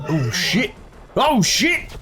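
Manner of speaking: a high, startled "Oh shit!"; shocked outburst. Real, not acted. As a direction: jolt up in real surprise. Sudden shock at gameplay.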